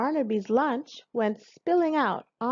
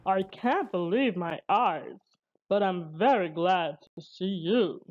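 lower-pitched character voice of a rescued man; rescued sea captain; 3 semitones lower than usual. A narrator's reading. Rescued captain greets Barnaby, low character voice. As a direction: voice a relieved seaman, kept low. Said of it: "said the man".

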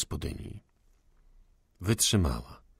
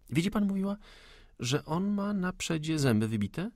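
much higher, playful lady's voice; light joking woman; 10 semitones higher than usual. Acted. Salomea distracts the gunman with a playful anecdote. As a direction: coax him with a bright joke. Salomea tries to cheer him.

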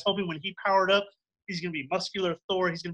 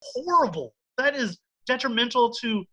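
outraged; 5 semitones higher, 5 dB louder than usual. Real, not acted. Outraged rant about Thor.

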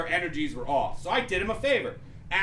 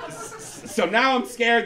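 usual speaking voice

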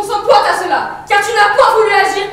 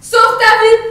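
loud, urgent plea; urgent cry; 4 semitones higher, 6 dB louder, slower than usual. Acted. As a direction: push her to save herself. Antigone urges her sister.

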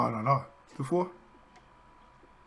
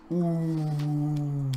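ordinary talk, nothing marked

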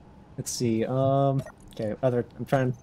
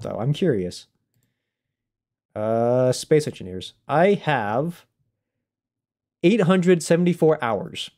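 ordinary talk, nothing marked

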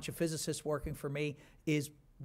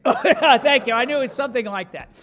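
much higher and louder over laughter; loud laughing thanks; 9 semitones higher, 14 dB louder than usual. Real, not acted. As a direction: ride the laugh loudly. Laughs at joke, crowd.

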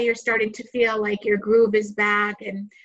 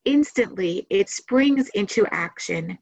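guided meditation. Acted. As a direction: guide listeners into relaxation, unhurried phrase by phrase.

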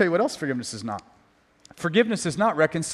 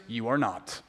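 a dry, quiet no; deadpan low refusal; 9 semitones lower, 8 dB quieter, slower than usual. Acted. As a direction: drop it flat and soft, much lower. Deadpan punchline, dropped.

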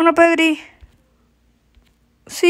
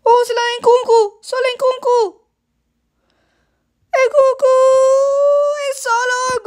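high excited play-by-play; excited commentary; 10 semitones higher, 5 dB louder than usual. Real, not acted. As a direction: call the chance at full pitch. Live goal chance call.

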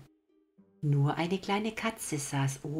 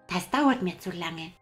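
put-on impatient little boy; 4 semitones higher than usual.

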